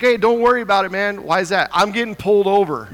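loud, rushed exasperation; hurried and exasperated. Retold or imitated. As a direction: blurt it out while pulled over. On phone, getting pulled over.

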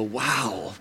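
soft, low-key wow; quiet awe. Acted. Townsperson seeing Owen turned pro, quietly awed. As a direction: murmur it in hushed amazement. Imagined onlooker marveling at Owen.

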